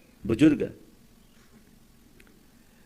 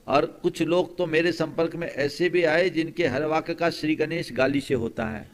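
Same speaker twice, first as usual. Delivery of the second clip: ordinary talk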